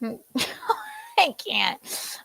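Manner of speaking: breathless laughter through words; laughing. Real, not acted. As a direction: crack up, can barely speak. Laughing at the video.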